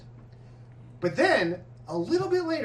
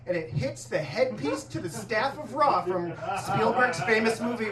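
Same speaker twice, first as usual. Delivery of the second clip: normal talking voice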